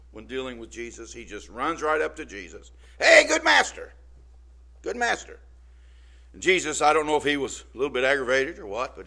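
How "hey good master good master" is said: much higher, loud eager call; eager young man. Retold or imitated. Rich young man hailing Jesus, brash and eager. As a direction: run up calling out like a brash youth.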